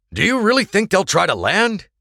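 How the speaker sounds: calling out. A narrator's reading. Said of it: "James Goodwin called from the dark".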